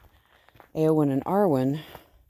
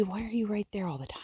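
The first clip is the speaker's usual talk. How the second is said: quick breathy whisper to the sheep; 6 semitones higher, 8 dB quieter, faster than usual. Real, not acted. Owner whispering fondly to her clingy sheep, hushed. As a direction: murmur it fast and secret, nose to nose. Fussing over sheep up close.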